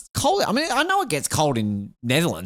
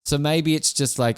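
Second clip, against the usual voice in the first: ordinary delivery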